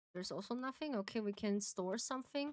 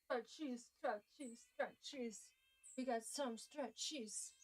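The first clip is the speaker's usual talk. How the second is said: quiet mumbling; 5 semitones higher, 8 dB quieter than usual. Real, not acted.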